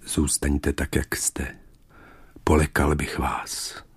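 low, soft, restrained monster voice; Beast. A narrator's reading. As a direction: give a quiet, low-pitched command as the Beast. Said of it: "protože Netvor jí poručil".

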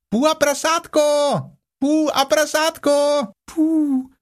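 very high excited squeaking call; a little one calling down from a tree. A narrator's reading. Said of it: "něco velmi rozčileně zapištělo". (rozčilené pištění klokánka ze stromu)

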